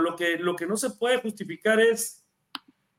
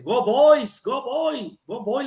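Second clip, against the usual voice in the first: higher, half-shouted cheer; retold kid's shout; 5 semitones higher, 4 dB louder, much slower than usual. Child fan cheering on Isaiah Pacheco, mock shout. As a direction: imitate a young fan calling out to a player. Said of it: "le empieza a gritar".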